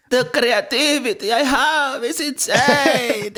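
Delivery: very high, drawn-out cartoonish voice; cartoon version. Mocking the film's cartoonish son, high slow hammy voice. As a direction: squeal it slowly like a cartoon eccentric. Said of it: "made him a cartoon version of himself".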